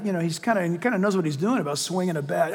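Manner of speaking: ordinary talk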